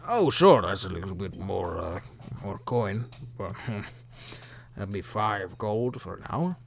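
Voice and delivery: put-on a touch of drunken slur; drunk middle-aged man. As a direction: haggle as a slightly tipsy boatman. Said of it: "drunk middle-aged man".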